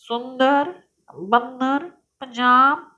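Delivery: words spoken with nose pinched closed; nose held shut. Shown as a demonstration. Teacher demonstrating to children, speaking with nose pinched shut. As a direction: pinch the nose and say सुंदर, बंदर, Punjab. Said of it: "अब जरा नाक बंद कर कर".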